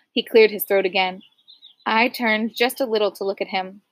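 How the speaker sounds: plain reading voice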